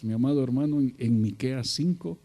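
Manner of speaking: usual speaking voice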